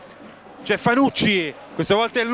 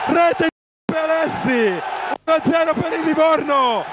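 breathless italian goal commentary; 5 semitones higher than usual. Real, not acted. Goal scored, live commentary.

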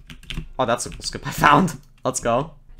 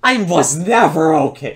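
frantic rant; 3 semitones higher, 6 dB louder than usual. Real, not acted. Mock-frantic rant after stream.

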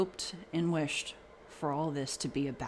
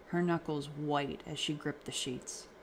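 ordinary delivery

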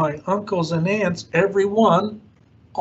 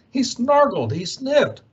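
usual speaking voice

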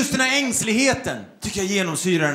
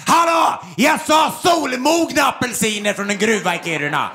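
diva screaming at staff. Acted. Touring diva scolds backstage crew, mock yelling. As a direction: yell the absurd demand like a diva. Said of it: "skrika på folk".

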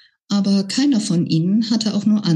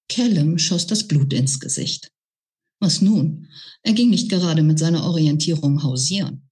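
ordinary delivery, nothing marked

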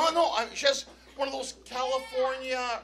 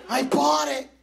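louder, punched admission; loud confession; 2 semitones higher, 5 dB louder than usual. Retold or imitated. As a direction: own up at full voice. Admits giving in, said twice.

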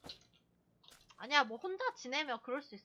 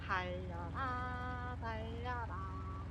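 slow urging; 5 semitones lower, 8 dB quieter, much slower than usual. Real, not acted. Urging the car uphill.

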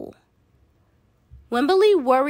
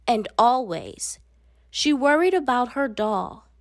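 plain reading voice

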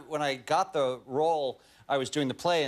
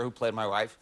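ordinary delivery

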